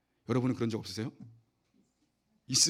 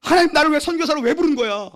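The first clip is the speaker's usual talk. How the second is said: high, loud indignant cry to God; mock rant at God; 12 semitones higher, 10 dB louder than usual. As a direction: shout your complaint to heaven. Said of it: "그 다음 뭐예요".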